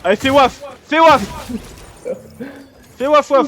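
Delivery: high loud mocking call; taunting. Real, not acted. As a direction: taunt them like calling dogs. Taunting downed enemies like dogs.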